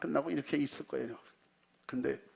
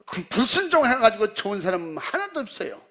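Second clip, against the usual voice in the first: a raised, scolding pastor's rebuke, higher and louder; angry rebuke; 5 semitones higher, 8 dB louder than usual. Retold or imitated. Pastor scolding the disobedient congregation, angry and raised. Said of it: "그게 화난 거지".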